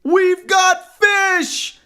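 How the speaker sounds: high, loud, drawn-out holler; slow, stretched excited yell; exultant shout. A narrator's reading. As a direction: shout the news of a catch. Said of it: "exclaimed the fishermen".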